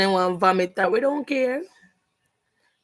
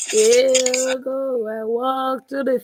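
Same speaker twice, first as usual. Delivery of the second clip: mock psalm recital; 3 semitones higher, slower than usual.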